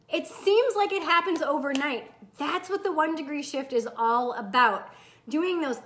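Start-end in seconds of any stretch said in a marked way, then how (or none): none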